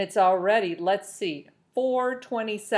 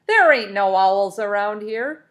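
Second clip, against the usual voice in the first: loud denial; 2 semitones higher, 10 dB louder than usual. A narrator's reading. Foreman denying loudly.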